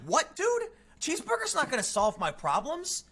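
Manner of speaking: What, dude? jumping high in disbelief; incredulous retort. Retold or imitated. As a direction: scoff at the salesman in disbelief. Incredulous reply to the salesman.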